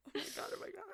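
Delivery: breathless, tearful oh my God; real crying. Real, not acted. Overcome with grief over a character's death, weeping. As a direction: choke it out through tears. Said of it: "she is crying".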